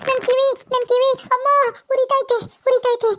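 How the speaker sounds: high, pained cries as her ear is twisted; pained yelps. Acted. Shilpa cries out to the ghost twisting her ear, high pained wail. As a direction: wail about the burning ear.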